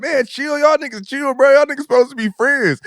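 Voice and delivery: calm them down, lightly voiced; friends breaking it up. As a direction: friends trying to stop the fight. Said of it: "Everybody be like".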